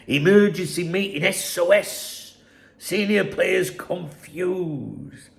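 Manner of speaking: slow, dramatic mock headline; mocking headline read. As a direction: spell it out like a tabloid alarm. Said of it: "exclusive with a bomb emoji".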